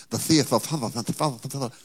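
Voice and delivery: "The theoth of" with tongue between teeth, lisped, very slow; mock King James lisp. Shown as a demonstration. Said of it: "stick your tongue between your teeth and talk".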